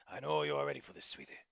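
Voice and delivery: reassuring grandfather. Acted.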